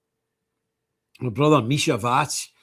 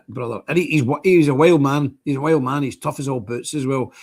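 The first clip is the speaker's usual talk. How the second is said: ordinary talk, nothing marked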